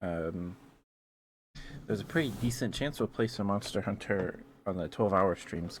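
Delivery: normal talking voice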